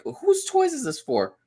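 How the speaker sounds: high, slow, surprised question; mock surprise. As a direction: feign shock at the toys. Dad spots toys in cart.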